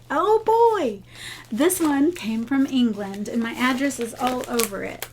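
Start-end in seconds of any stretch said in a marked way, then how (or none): 0.1-1.0 excited. Real, not acted. Vlogger thrilled as she opens a gift, high and delighted. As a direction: squeal it with glee at the package. Opening another gift package.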